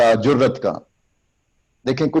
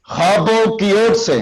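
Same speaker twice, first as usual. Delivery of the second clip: declaiming demo; 7 semitones higher than usual. Shown as a demonstration. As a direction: declaim it like a speech. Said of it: "یہ پھر میرے ہاتھ کو دیکھیں".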